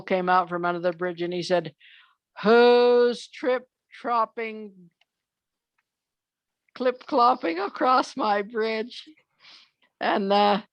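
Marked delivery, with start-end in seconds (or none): 2.4-9.1 slow, drawn-out storybook troll call; troll's challenge. Acted. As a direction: stretch out the troll's demand, pitched up. Troll challenges goat in tale.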